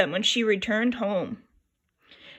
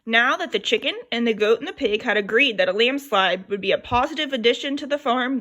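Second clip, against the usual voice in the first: ordinary delivery, nothing marked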